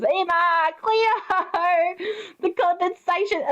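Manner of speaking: high, drawn-out melodramatic cries; mock-panicked calling. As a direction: call out names in hammed-up alarm. Repeats the panicked impression.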